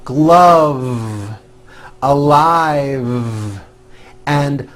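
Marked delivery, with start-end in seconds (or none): 0.0-3.6 GLOVE, ALIVE each ending on a long voiced V; final buzzed V. Shown as a demonstration. Teacher modelling word endings, long final V. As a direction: keep buzzing through the last V. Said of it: "now at the ends of words".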